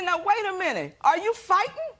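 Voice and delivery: high, indignant disbelief; shocked protest. Retold or imitated. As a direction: pitch jumps up in outrage. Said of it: "I said".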